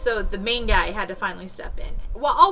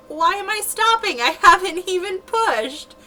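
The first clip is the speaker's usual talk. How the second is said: much higher, louder, slowing bewildered protest; confused alarm; 11 semitones higher, 7 dB louder than usual. Retold or imitated. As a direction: demand to know what's wrong. Confused patient told to stop.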